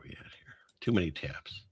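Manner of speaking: breathy whispered aside to herself. Real, not acted. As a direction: murmur it under your breath, almost unvoiced. Muttering to herself at screen.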